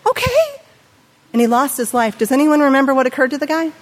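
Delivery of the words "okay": gullible surprise. Retold or imitated. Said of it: "And the guy's like".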